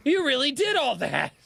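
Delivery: retold stunned admiration; awed exclamation. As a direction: say it with full astonishment now convinced. Retold reaction to scars.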